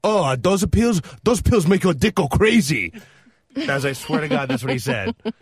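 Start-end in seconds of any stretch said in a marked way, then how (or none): 0.0-2.9 slow, loud mock Chinese accent; higher hammed-up Chinese shopkeeper accent; Chinese-accented pronouncement, slow and emphatic; imitated Chinese accent. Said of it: "then the Chinese guy said".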